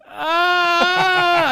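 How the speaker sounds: high, loud mock cry of pain; exaggerated kid's shriek; mock child's wail. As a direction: wail like a hurt little child. Retold child hurt after punching.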